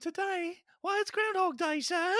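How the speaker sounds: put-on very high, eager little boy's voice; Dickensian street kid. Host voicing an eager urchin answering the gent, high and chirpy. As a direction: chirp it up like a cheeky Victorian urchin. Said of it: "some little, like, Dickensanian kid".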